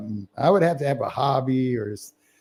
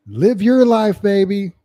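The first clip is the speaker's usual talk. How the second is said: higher and louder, hyped-up dad; cringey cool dad; 10 semitones higher, 5 dB louder, slower than usual. Retold or imitated. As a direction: cheer her on like an embarrassing dad. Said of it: "he's like".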